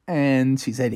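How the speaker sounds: plain reading voice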